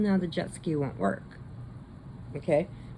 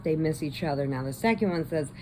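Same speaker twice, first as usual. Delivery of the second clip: usual speaking voice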